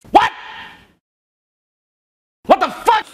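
very loud, high-pitched yell; startled high shout; shocked shout. Real, not acted. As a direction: burst out in loud disbelief. Shocked outburst during prank.

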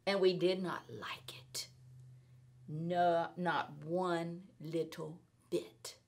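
whispered, glum and sulky. A narrator's reading. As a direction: breathe it out like a bored child. Bored children on rainy day.

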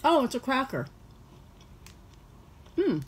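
speaking between bites; chewing while talking. Real, not acted. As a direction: mouth partly full of cracker. Seen 1: Bites cracker at -2s, jaw still working while speaking, bitten cracker held.

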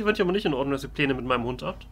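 ordinary delivery

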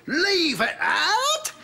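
high, drawn-out pitchman's cry; over-exclaimed slogan. Acted. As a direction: sell it with far too many exclamation marks. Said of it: "way too many exclamation marks after it".

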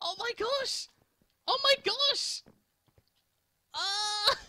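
ecstatic high screaming joy; shrieking jackpot winner. Real, not acted. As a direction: scream with disbelieving delight. Wins the game, screaming joy.

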